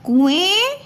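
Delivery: ordinary talk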